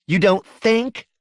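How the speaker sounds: slow, high, rising disbelief; incredulous challenge. Acted. Wife doubting husband's word on her mama's safety, incredulous. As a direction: draw it out as an appalled question.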